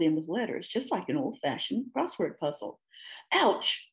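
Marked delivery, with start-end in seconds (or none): 3.3-3.9 sharp pained ouch, pitched up; snarling ouch, high and sharp; snarled ouch. Acted. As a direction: yelp it out at the feet on his nose.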